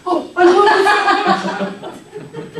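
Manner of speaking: slow, drawn-out, loud and laughing, squeezing a big hug; hammed-up gushing laugh. Shown as a demonstration. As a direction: gush it out slowly through laughter, hugely overdone. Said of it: "That's quite a hug".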